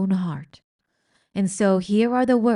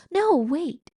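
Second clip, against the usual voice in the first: urgent princess; 10 semitones higher, 3 dB louder than usual. Acted. Princess stops Meg.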